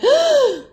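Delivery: sharp, high intake of breath; sudden audible gasp; shocked gasp. Retold or imitated. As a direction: gasp in stunned relief, acted out. Retold shock at seeing her phone.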